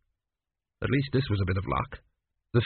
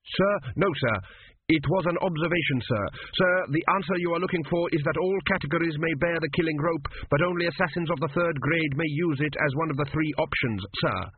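higher, rapid polite answers; anxious student; 5 semitones higher than usual. Acted. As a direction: answer fast and correctly.

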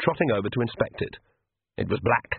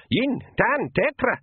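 high pixie battle cry; 12 semitones higher, slower than usual. Acted. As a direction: shout the count like a tiny warrior. Feegle counting off the charge.

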